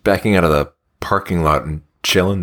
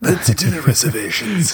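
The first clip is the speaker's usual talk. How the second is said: imitating the pterodactyl; 8 semitones higher than usual. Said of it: "raspy and smooth".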